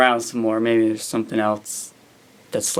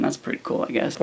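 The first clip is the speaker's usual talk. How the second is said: unimpressed whisper under the breath; whispered aside; 7 dB quieter, faster than usual. Real, not acted. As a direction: mutter it as a flat whispered aside.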